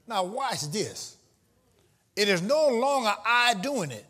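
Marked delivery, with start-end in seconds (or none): none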